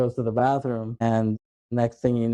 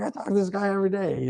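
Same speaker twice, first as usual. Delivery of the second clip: fast, high-pitched defensive imitation; mock-protest in a hurried high voice; retold protest; 8 semitones higher, faster than usual. Clerk defending himself to the gunman, retold protest.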